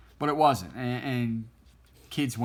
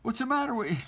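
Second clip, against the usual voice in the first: high, teasing big-brother voice; pitched-up mock jeer; mocking taunt. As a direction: rub it in that they ran away scared. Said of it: "He's like".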